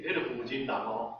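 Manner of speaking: ordinary talk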